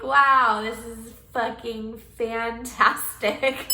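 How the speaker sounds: loud sarcastic "wow", then a slow bitter drawl. Retold or imitated. Mocking her useless doctor visit, loud and slowly sarcastic. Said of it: "So I was like".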